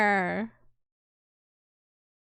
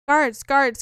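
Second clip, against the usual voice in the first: loud call for the guards, pitched up; 4 semitones higher, 6 dB louder than usual. Acted. Locked-up knight shouting for the guards, loud call. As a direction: shout down the corridor for help.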